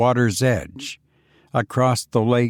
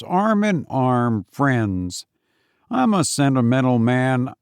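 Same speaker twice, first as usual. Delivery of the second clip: Foster's voice; 3 semitones higher than usual. Acted. Foster's sentimental speech.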